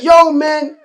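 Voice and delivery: lightly put-on Grenadian accent, calling out. Old-timer at the job addressing a younger co-worker, Grenadian accent. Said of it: "in his Grenadian accent".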